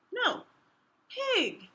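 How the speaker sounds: high insisting voice; Pig protesting. Acted. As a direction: correct him, pitched high. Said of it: "says Pig to Pug".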